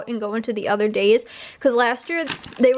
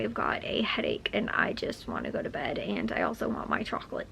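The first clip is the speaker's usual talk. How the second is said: soft breathy whisper; 11 dB quieter than usual. Real, not acted. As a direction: whisper it, worn out by a headache. Tired with a headache, winding down.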